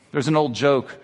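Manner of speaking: ordinary delivery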